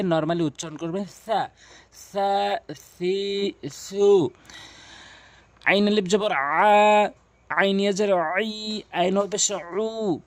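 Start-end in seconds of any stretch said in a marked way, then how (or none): 1.3-4.3 "সা, সি, সু" with tongue tip touching the teeth; th start on "সা", "সি", "সু", vowels held; slow, separated "সা, সি, সু" with a th sound; correct tha articulation. Shown as a demonstration. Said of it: "সাটি জিহ্বার আগা এবং দাঁতের আগার সঙ্গে". 5.6-10.2 final "আ", "আই", "উ" drawn long with throat pressure; letter names, then a long pharyngeal "আ", "আই", "উ"; ayn spelling drill. Shown as a demonstration. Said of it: "হলকের মাঝখান থেকে চাপ দিয়ে".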